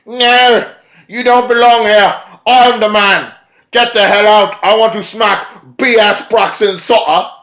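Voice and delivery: high, loud shouty rant; mock Spud rant. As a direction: yell him out of the ring. Spud voiced angrily.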